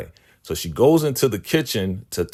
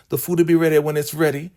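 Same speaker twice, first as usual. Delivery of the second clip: sassy retort; 9 semitones higher, 4 dB louder, faster than usual. Retold or imitated. Elder Mother snapping at Manny.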